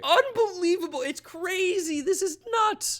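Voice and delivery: high and loud, overwhelmed; freaking out. Real, not acted. Mets fan freaking out over Correa, loud and high. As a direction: burst out in giddy disbelief.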